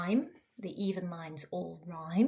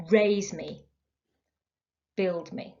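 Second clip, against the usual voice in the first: "Raise me" and "build me" hit on "Raise" and "build"; strong-weak beat on "Raise me, build me"; trochaic stress; slower than usual. Shown as a demonstration. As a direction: lean on the first syllable of "Raise me" and "build me". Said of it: "the stress shifts to the first of the paired syllables".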